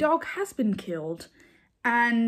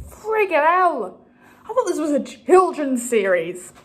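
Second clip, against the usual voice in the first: exasperated disbelief; 3 semitones higher, 7 dB louder than usual. Retold or imitated. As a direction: let the frustration drag out each word. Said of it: "And I'm like".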